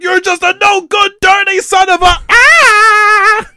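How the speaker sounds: very high, loud yell stretched into a long scream; hammed-up furious shout ending in a howl; Tuco. Tuco cursing at the movie's end, screaming. Imitating Tuco's final yell.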